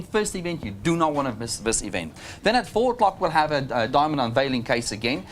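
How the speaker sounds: usual speaking voice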